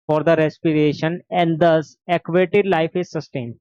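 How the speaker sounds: slow, deliberate textbook reading; dictation. Acted. Teacher dictating notes to the class, slow and deliberate. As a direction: spell out each phrase for notes.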